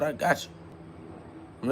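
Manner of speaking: breathy whispered promise. Real, not acted. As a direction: whisper it like a secret. Private promise to his kids.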